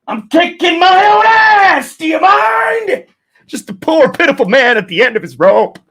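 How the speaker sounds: manic, high, loud shouting; Jim Carrey impression. Streamer mimics Jim Carrey in Liar Liar, manic shouting. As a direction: stretch the words into a strained comic yell. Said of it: "Like Jim Carrey in Liar Liar".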